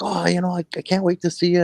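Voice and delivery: imitated child, voice lifted high; eager son. Son talking to his visiting dad at school, imitated eager child. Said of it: "He's like".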